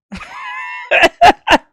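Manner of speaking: high, loud cackle; laughing out loud. Real, not acted. Streamer amused by odd anger comparison, loud high laugh. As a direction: burst out in high-pitched laughter.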